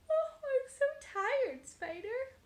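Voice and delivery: high, slow tired cat; sleepy cat voice. Acted. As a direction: yawn it out like a drowsy cat.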